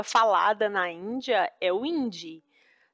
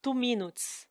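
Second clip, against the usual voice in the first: put-on Indian English accent; 2 semitones higher, 7 dB quieter than usual. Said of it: "lembra que era o".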